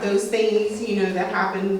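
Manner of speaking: ordinary delivery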